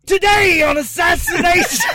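hammy ogre show intro; Guy Fieri as Shrek. Pretend. Opening a fake podcast episode on request, mock impression. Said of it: "Guy Fieri as Shrek".

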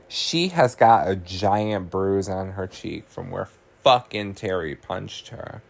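ordinary talk

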